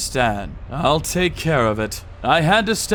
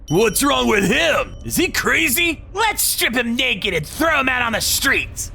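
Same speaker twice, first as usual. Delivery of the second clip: higher, yelling contempt; jeering thug; 10 semitones higher than usual. Acted. Thug mocking the beaten client.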